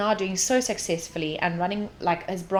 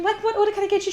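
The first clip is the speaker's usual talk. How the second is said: service voice; 12 semitones higher, much faster than usual. Retold or imitated. Her own service voice.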